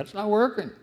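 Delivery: fed-up verdict thrown at God; blunt complaint. Retold or imitated. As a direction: spit it out in frustration. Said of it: "just says to God".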